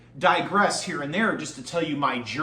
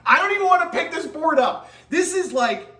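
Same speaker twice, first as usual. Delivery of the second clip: high, loud giddy gushing; excited; 13 semitones higher, 6 dB louder than usual. Real, not acted. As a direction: gush in delight. Giddy over board.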